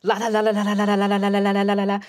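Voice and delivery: rapid monotone la-la-la; la-la-ing to block out talk. Acted. [name] refusing to hear talk of twists, droning la-la. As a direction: babble la-la on one note to drown them out. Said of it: "[name] is doing this".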